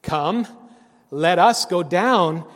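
very loud, slow summons; divine proclamation. Acted. The Lord in dialogue within the Trinity, proclaiming. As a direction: boom it out, stretching the words. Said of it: "Father, Son, and Holy Spirit dialogue".

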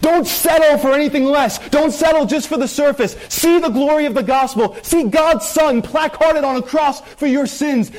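high, ringing climax; full-force preaching. Acted. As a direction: lift the whole church up high.

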